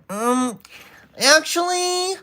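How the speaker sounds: very high, drawn-out "actually"; nerdy know-it-all. Acted. Streamer testing a know-it-all voice on chat, very high "actually". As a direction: correct someone like an insufferable nerd. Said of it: "cycle through voices".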